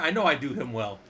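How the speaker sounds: ordinary delivery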